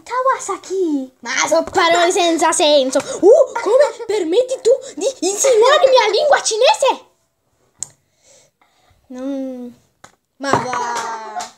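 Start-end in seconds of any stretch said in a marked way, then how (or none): 0.0-1.2 fake Chinese gibberish. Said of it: "so parlare in cinese". 3.0-7.1 high, loud mock outrage; hammed-up offended shout; mock indignant. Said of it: "so parlare in cinese".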